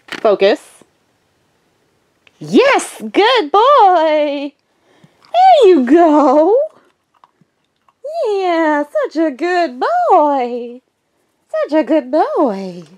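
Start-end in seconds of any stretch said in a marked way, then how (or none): 2.4-12.8 high, slow, cooing praise; excited puppy praise. Real, not acted. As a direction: gush over the puppy, stretching the vowels.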